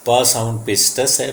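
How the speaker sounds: usual speaking voice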